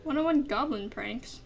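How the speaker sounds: ordinary delivery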